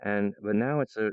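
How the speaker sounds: ordinary talk, nothing marked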